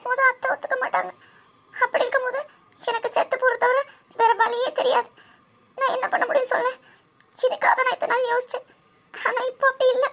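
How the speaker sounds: crying out the fear of losing Karthik; tearful. Acted. Friend weeps to friend about losing her love, voice breaking. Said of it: "இப்ப நீயும் இப்படி அழுதுட்டு இருந்தேன்னு".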